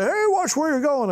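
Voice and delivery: high, indignant yelp; a cartoon worker's squawk, pitched high; annoyed construction worker. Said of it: "and they say".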